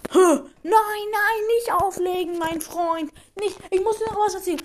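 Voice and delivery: high, pleading voice; pleading friend. Acted. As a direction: beg him not to hang up. Friend begs not to hang up.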